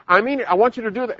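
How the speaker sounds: high, quick insistence; acted parent losing patience; exasperated parent. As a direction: push the order, pitch climbing. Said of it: "finally the parent's yelling and screaming".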